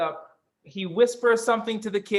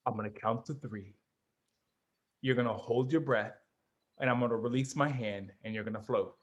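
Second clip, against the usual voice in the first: low, much softer murmur of the lifeguard; low whisper; 10 semitones lower, 8 dB quieter than usual. Retold or imitated. Lifeguard instructing a kid in the pool, low hushed murmur. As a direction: murmur it low so they have to lean in. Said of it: "All of this in a low to, a whisper".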